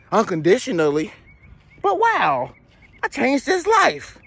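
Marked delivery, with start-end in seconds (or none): 1.8-4.2 slow high self-satisfied wow; high proud voice. Acted.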